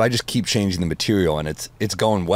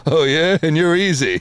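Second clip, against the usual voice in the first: high, loud put-on Rodney comeback; Rodney Dangerfield; 9 semitones higher, 4 dB louder than usual. Rodney firing back at his wife in Back to School, high. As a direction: snap back the insult as Rodney. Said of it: "Back to School, his wife's like".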